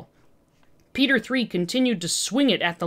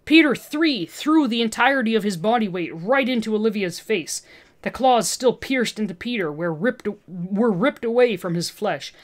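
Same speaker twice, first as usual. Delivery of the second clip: usual speaking voice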